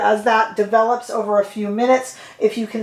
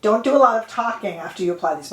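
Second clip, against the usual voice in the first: ordinary talk, nothing marked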